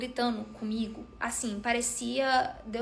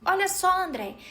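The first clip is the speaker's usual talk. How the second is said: mocking relative; 5 semitones higher, 3 dB louder than usual. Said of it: "ela falou assim".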